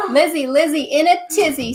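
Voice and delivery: loud sing-song taunt; shouted taunt. A narrator's reading. Sister shouting a taunting rhyme at Lizzie, loud sing-song. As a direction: shout the rhyme back at her. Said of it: "Sister shouted back".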